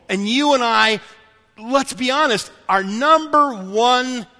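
emphatic preacher, stretching key words; impassioned preaching. Acted. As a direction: press the point on the congregation.

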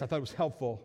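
ordinary talk, nothing marked

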